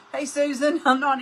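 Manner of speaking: loud, high call across the room. Real, not acted. Calls out to commenter Susan, loud and high. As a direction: call her name out.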